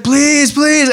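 high, pleading please, please; begging widow. Retold or imitated. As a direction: plead desperately, pitched high. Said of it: "keep begging".

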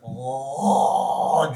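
long imitated drooling "oh"; drooling moan. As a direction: draw out a hungry cartoon moan.

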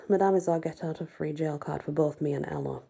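usual speaking voice

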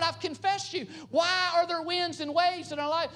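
high, desperate pleading voice; pleading disciples. Acted. Disciples crying out to Jesus in their trouble, pleading. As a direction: cry out to the Lord in distress. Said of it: "Here they are saying".

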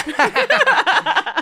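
high burst of laughter; loud laugh. Real, not acted. Burst of laughter after greeting.

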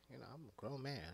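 normal talking voice

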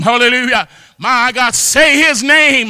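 ordinary talk

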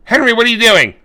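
high, loud call to Henry; a mother shouting after her son. Retold or imitated. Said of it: "She goes".

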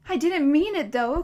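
loud, high outburst; voice rising. A narrator's reading. Boy protesting to the monster, voice rising loud. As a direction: cry it out desperately. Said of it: "his voice rising".

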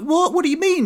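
high, surprised What? What do you mean?; incredulous. Retold or imitated. Son baffled that mum refuses the holiday, incredulous high squeak. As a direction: squeak out your disbelief.

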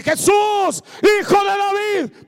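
full-force high cry. Acted. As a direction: yell it to wake the back rows. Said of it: "empezó a gritar más fuerte".

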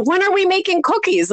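high-pitched imitation of a child; eager kid. Kids asking babysitter for cookies, eager. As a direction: pipe up like a kid wanting a treat.

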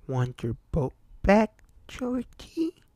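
high sly clown voice, lilting up; creepy clown voice. A narrator's reading. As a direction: lure him in, sing-song and high. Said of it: "the clown speaks".

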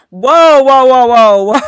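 ordinary talk, nothing marked

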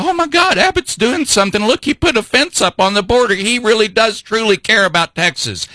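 high-pitched gushing imitation of an excited supporter; mock excited. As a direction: gush in a mocking, high voice. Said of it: "you're gonna get all excited and go".

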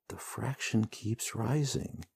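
slow, soft, sinking; dismayed repeat. A narrator's reading. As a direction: let it sink in with dread. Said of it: "the hero repeats".

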